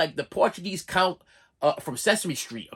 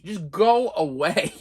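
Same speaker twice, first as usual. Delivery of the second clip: slow, weary drawn-out go away; much slower than usual. Retold or imitated. Patient silently begging the nurse, slow and weary. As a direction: stretch it out wearily. Pleading inner wish.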